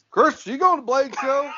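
high drawling cowboy; lightly voiced [name]. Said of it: "Is that your cowboy voice?".

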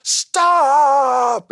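a sudden high, loud shout of Stop!; pretend yell. Watching a frozen promo, yelling high and loud to end it. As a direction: blurt it out like you can't take the silence. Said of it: "I wanna just jump up and yell".

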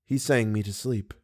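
ordinary delivery, nothing marked

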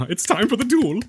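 high, giggly mock king voice; teen king relishing the duel; weird gleeful king. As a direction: laugh through it like a delighted boy king. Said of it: "he did have that weird affect".

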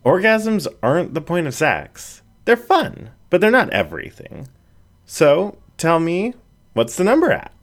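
a cheeky friend pushing for the answer; playful border collie. A narrator's reading. Annie presses Jen.

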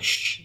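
soft hushing "shh"; hushed imitation of shushing; retold shush. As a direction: imitate a quiet warning shush. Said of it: "he's like".

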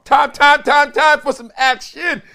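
high, loud announcer call; hype call. Acted. Hype call reading comments.

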